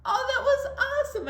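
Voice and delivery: high, loud, quick burst of delight; delighted praise. Real, not acted. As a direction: cheer them on excitedly, high and fast. Praising children after their moos.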